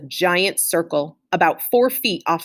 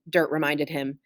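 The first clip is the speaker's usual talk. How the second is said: ordinary delivery, nothing marked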